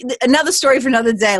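ordinary delivery

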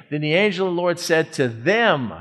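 "them" stretched and weighted at the end; stress on THEM. Shown as a demonstration. Preacher showing whom the angel addressed, stress on "them". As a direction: land firmly on the last word, "them". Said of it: "allows me to emphasize certain words".

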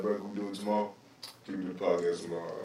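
soft, fast, low mumble of a hungover friend; mock hungover whine. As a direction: plead weakly to skip it. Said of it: "You probably would've been like".

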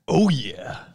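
big, drawn-out mascot voice; Kool-Aid Man impression. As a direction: boom it out like a drink mascot. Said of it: "That's Kool-Aid".

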